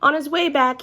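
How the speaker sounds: usual speaking voice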